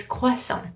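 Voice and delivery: French croissant without the T; silent final T. Shown as a demonstration. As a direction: leave the T off croissant. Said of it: "You don't pronounce the T".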